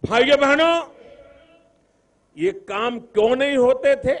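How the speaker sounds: loud, high rousing question; shouting rally speech. Real, not acted. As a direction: rouse the crowd. Rally crowd, rising rhetorical question.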